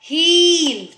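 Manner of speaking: "हील" with a long held ee; sustained ee in "हील". Shown as a demonstration. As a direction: draw out the vowel in "हील". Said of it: "हील बोला है तो उसमें बड़ी".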